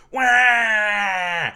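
loud wailing jingle cry; a loud comic radio-jingle wail; jingle yell. Acted. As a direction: belt out a silly station ident wail. Jingle shout.

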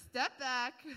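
a touch of a stern warning over your ice cream; mock warning. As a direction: shove the kid off with a half-joking bark. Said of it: "You'd be like".

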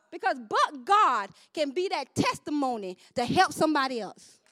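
ordinary delivery

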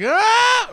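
short high loud ah cry; high mock scream. Host imitating the monster's scream, high and loud. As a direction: yelp it out.

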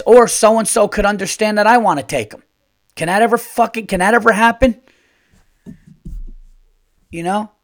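loud and high, fed-up yelling; exasperated rant. Real, not acted. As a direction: vent the frustration at full volume. Ranting about relatives taking kids.

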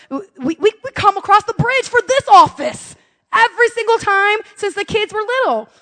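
high, stammering indignation; indignant protest. Retold or imitated. Mom arguing with the receptionist, high and indignant. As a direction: rise high in exasperated protest.

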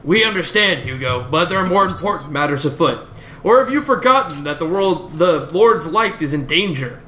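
lightly voiced concerned adventurer; gently remonstrate in a put-on character voice; lightly acted lilting objection; Linkara. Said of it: "And Linkara chimes up".

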